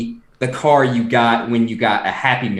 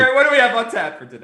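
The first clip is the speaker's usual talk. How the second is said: fast handoff; 8 semitones higher, much faster than usual. Acted. Host handing over fast.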